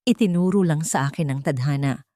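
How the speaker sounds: ordinary delivery, nothing marked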